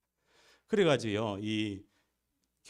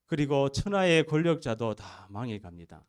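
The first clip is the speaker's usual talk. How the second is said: bold proclamation; 4 semitones higher, 8 dB louder than usual. Real, not acted. Preacher's new bold claim.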